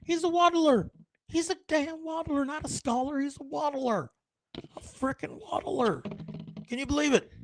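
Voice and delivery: high squeaky squirrel trash talk; gossipy squirrel voice. Retold or imitated. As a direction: squeal it like a mean little rodent mocking a waddler. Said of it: "he's like".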